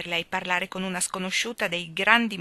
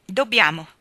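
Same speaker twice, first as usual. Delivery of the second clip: rather cold; 8 dB louder than usual. A narrator's reading. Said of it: "disse abbastanza freddamente Anna".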